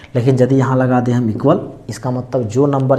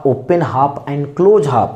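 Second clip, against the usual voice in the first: slow key term; much slower than usual. Shown as a demonstration. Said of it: "teacher stresses key term".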